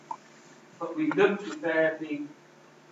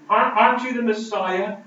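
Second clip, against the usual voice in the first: louder jeering challenge, slowing at the end; mocking criminal; 5 semitones higher, 7 dB louder than usual. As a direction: taunt him from a nearby cross. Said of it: "the criminals turned him to him".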